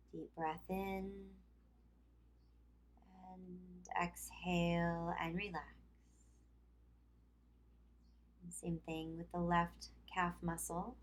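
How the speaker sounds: calm yoga instruction with long pauses; guided relaxation. Acted. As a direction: lead them through tensing and releasing, leaving room to breathe. Leads tense-and-release relaxation exercise.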